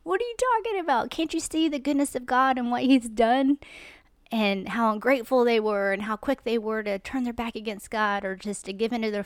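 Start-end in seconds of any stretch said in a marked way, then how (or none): 0.0-3.6 incredulous. Retold or imitated. Scolding the ungrateful Israelites, incredulous and climbing high. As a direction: pitch jumps high on What, then rising disbelief. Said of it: "You're like".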